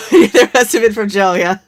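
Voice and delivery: laughing it out; words through a big laugh. Real, not acted. Laughing at the dwarf seeds joke.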